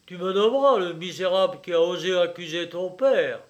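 exasperated. A narrator's reading. Father demanding his accuser's name, exasperated. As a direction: demand the name, head lost. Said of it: "acheva d'exaspérer le marchand de biens et lui fit perdre la tête".

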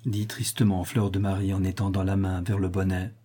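ordinary delivery

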